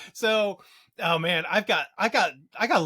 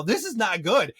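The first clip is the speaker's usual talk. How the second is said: normal talking voice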